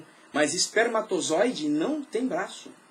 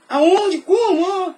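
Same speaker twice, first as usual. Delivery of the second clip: mock confused questions; 10 semitones higher, 9 dB louder, much slower than usual. Pastor mimics confused listener.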